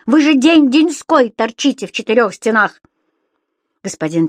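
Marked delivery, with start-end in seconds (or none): 0.1-2.8 loud, higher-pitched scolding; a loud landlady's shout with the pitch raised. A narrator's reading. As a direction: shout it at the lodger as he leaves. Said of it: "снова закричала хозяйка".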